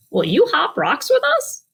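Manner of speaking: storyteller lightly voicing a frog. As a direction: ask like a bouncy frog. Frog speaks in picture book.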